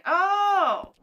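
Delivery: a high, bright oh of discovery; sudden realization. Real, not acted. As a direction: it just clicked for you. Realizing what bangers means.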